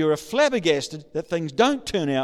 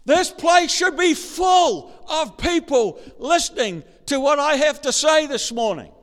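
loud, high, slow preaching shout; shouting preacher; 8 semitones higher, 5 dB louder than usual. Real, not acted. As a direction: fill the hall with it. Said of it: "I know I'm shouting".

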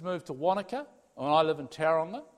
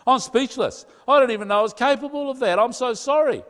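higher, louder stricken apology; anguished remorse; 8 semitones higher, 7 dB louder than usual. Retold or imitated. A husband learning how he hurt his wife, high and loud. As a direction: burst out in shock at himself.

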